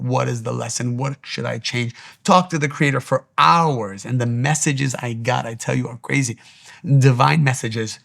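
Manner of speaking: normal talking voice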